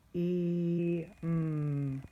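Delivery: "T-E-M" as separate sounds; sounding out. Shown as a demonstration. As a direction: sound out "T-E-M" slowly. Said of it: "Here's the next one I'm gonna sound out".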